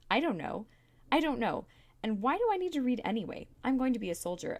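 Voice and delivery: put-on high, loud child's tantrum; angry shout. A narrator's reading. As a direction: shout defiantly in frustration. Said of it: "and shouted".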